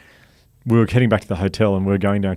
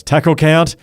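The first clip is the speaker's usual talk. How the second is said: loud, higher demand; impatient call; 8 semitones higher, 8 dB louder, slower than usual. Retold or imitated. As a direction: snap it out louder. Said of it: "And Billy goes".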